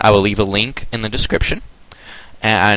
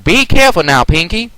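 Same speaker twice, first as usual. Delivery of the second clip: higher, louder, a tad nervous warning; nervous Applejack; 9 semitones higher, 4 dB louder than usual. Acted. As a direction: warn her gently but anxiously. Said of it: "a tad bit nervous".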